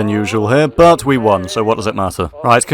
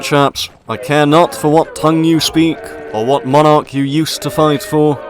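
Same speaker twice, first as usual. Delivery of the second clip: ordinary talk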